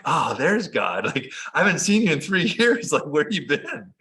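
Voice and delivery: laughing amazed reunion with God; joyful disbelief. Retold or imitated. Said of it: "I'm like".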